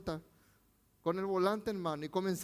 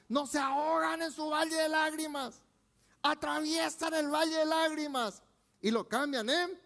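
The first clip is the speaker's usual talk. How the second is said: raised, driving preacher voice; fiery preaching; 7 semitones higher than usual. Acted. Pastor exhorting his congregation, fiery preaching. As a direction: preach it high and hard to the church.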